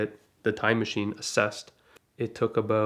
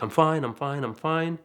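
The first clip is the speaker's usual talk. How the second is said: higher-pitched, repeated insistence; insistent mock reassurance; 4 semitones higher than usual. Passenger waking after the crash to driver, imitated insistence. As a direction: imitate someone hurriedly insisting.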